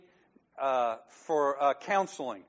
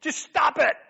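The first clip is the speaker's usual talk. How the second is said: high, loud shout of stop; yell; 12 semitones higher, 7 dB louder than usual. Retold or imitated. God yelling at the preacher to stop. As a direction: yell it like God scolding you. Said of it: "God is wanting to yell at me".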